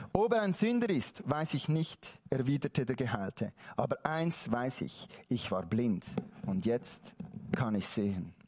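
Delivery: subdued, measured reading that drops deep; hushed scripture reading. A narrator's reading. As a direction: let the healed man's answer settle down low. Reads the healed man's answer.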